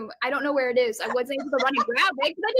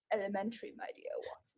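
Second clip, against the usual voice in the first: very soft, low aside; muttered quote; 9 semitones lower, 18 dB quieter, much faster than usual. Acted. Contestant belatedly recalls Sherlock's catchphrase, very soft and low. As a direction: say it under your breath.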